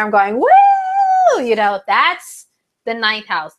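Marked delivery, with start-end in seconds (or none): 0.0-1.4 the woo shot up high and loud, slow; excited woo. Pretend. Vlogger acts out a carefree Libra with a big high cheer. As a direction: throw your hands up and cheer the woo. Pretend Libra living life fully.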